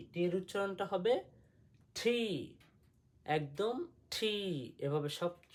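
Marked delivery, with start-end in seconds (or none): 2.0-2.2 "ঠি" with a hard puff of air on t; firm aspirated T. Shown as a demonstration. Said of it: "শক্তভাবে". 4.1-4.3 "ঠি" with a hard puff of air on t; firm aspirated T. Shown as a demonstration. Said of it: "শক্তভাবে".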